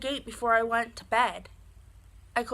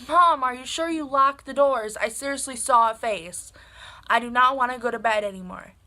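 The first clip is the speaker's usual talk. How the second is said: louder retold pleading with mom; insistent protest; 2 semitones higher, 7 dB louder than usual. Daughter telling mom she saw a face, loud insistent protest. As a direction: press the point, loud and urgent.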